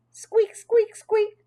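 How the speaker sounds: very high, thin little squeaks; high hamster squeaks in bouncy rhythm; squeaking jingle bells. A narrator's reading. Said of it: "It sounded like jingle bells".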